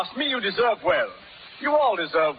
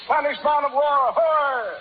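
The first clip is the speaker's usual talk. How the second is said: hailing a ship; 6 semitones higher, slower than usual. Acted. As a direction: call out to the silent ship.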